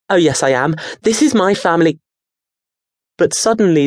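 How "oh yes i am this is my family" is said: put-on boy voice. Daniel protests.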